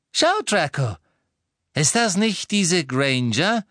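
high, loud, insistent lady; excited recognition. A narrator's reading. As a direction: recognise her and press for confirmation. Mother excitedly recognises the prisoner. (erregt, laut und hoch)

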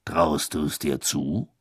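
slow, lightly voiced official; Bagman voice. A narrator's reading. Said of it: "fragte Bagman".

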